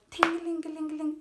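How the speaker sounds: high, loud mock ringing sound; imitated ringing. Recalling the crash aftermath, high loud ringing. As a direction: sing out the ringing in her ears.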